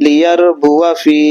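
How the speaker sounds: লিয়ারবুয়া ফি drawn out very slowly, alif not read; slow teaching recitation of লিয়ারবুয়া ফি; Quranic reading demo. Shown as a demonstration. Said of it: "আর পড়বো আমরা কিভাবে?". (ধীরে ধীরে লিয়ারবুয়া ফি উচ্চারণ)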